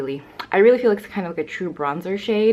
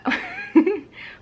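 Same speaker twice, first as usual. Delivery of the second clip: high laugh. Real, not acted. Laughing at the dog.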